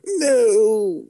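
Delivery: high, drawn-out mock cry of no; mock dismay. Podcaster joking about the orc attack, mock-dismayed cry. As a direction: wail it high in hammed-up dismay.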